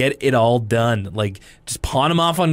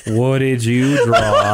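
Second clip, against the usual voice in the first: slow, bored drawl; 4 dB louder, much slower than usual. Retold or imitated. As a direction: ask it totally uninterested. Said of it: "they're both so bored".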